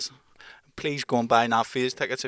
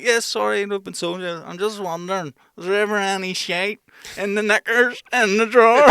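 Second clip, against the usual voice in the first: mock caller voice; 9 semitones higher, 8 dB louder than usual. Prank caller asking about the chest of drawers, higher and put-on. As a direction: put on a silly higher voice, building to loud. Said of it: "she's like".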